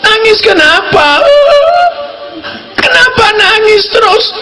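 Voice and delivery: mother pressing her sobbing daughter, very high; anxious mother asking. Retold or imitated. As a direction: plead high and long, over and over.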